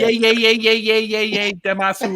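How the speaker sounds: high, excited chanting yays; Diana impression. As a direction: chant it like a giddy cheerleader. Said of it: "Oh, Diana, yeah, yeah".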